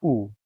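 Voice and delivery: U as an "oo" vowel, as in put; loud, clear U like "oo"; O sounded as U. Shown as a demonstration. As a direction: say U with rounded "oo". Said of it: "A single O sounds".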